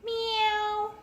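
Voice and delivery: very high kitten meow; high squeaky kitty meow; cat meowing. A narrator's reading. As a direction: mew like a tiny cat. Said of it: "The cat said".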